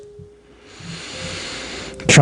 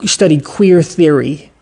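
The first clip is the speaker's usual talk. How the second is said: ordinary talk, nothing marked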